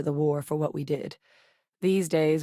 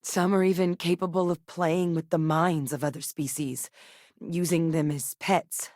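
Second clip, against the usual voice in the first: ordinary delivery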